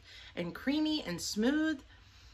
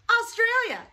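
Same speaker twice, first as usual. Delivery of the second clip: excited exclamation; 11 semitones higher, 4 dB louder than usual. Real, not acted.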